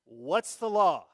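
What's the law said again, loud and slow; demanding repeat. Retold or imitated. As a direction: insist like a petitioner who wants rules. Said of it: "they just wanted to know".

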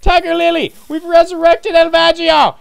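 high, loud announcement; triumphant high holler; excited calling out. Pretend. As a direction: shout the big news across to someone. Calls out news to Tiger Lily.

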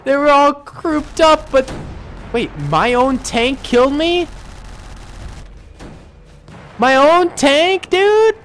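high, loud, incredulous outcry; dismayed disbelief. Real, not acted. Player killed by his own tank, indignant yelp. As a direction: protest loudly, voice pitched right up.

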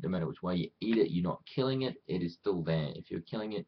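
usual speaking voice

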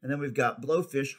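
usual speaking voice

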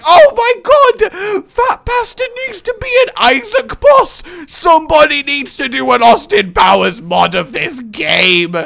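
high, loud excited outburst; thrilled shouting at a great idea. Real, not acted. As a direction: burst out with real delight. Excited outburst about a boss idea.

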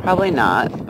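normal talking voice

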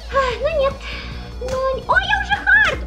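high excited squeal. Real, not acted. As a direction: squeal it with glee.

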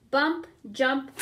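plain reading voice

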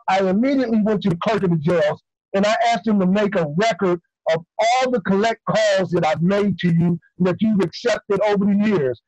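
ordinary talk, nothing marked

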